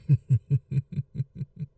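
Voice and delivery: short, dark laugh; sly chuckle. Acted. Vampire after the toast, sly chuckle. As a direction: savour the double meaning.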